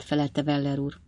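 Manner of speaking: ordinary delivery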